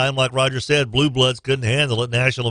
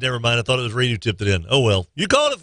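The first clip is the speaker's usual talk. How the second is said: usual speaking voice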